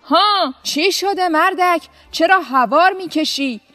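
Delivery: shouting governor. A narrator's reading. Governor yells at the noisy farmer, loud and angry. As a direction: snap at the farmer to stop his racket. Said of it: "داد زد".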